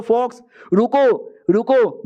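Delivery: high, drawn-out plea to stop; urgent call. Retold or imitated. Hero trying to stop the fox from killing, acted urgent call.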